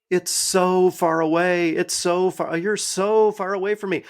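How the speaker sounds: high, drawn-out mock lament; mock whining. Mocking a movie character who complains of the distance, whining. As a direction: whine it slowly, hamming up the distance.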